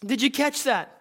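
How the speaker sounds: ordinary talk, nothing marked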